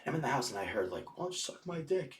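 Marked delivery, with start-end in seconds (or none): 1.2-2.1 imitated taunt. Imitating a taunt yelled outside.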